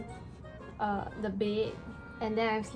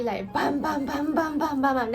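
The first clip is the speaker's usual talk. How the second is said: each bam louder and higher; building bam sounds; 5 semitones higher, 4 dB louder than usual. Retold or imitated. Imitating kicking the bed.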